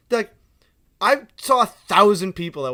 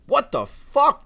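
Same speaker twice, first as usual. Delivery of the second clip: higher, slow, drawn-out incredulity; acted disbelief; 10 semitones higher, 6 dB louder, much slower than usual. Worker reacting to a rude customer, mock-incredulous. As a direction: stretch out the baffled exclamation.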